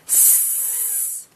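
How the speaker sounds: long unvoiced "S" hiss; slowly. Shown as a demonstration. Teacher showing a child the slow sound, held. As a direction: hold "S" as one long soft hiss. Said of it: "First slowly".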